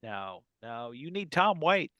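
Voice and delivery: ordinary talk, nothing marked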